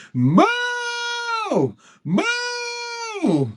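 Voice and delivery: long drawn-out cow moos, rising high; playful sustained mooing; imitated child's moo. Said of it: "She does it a second time".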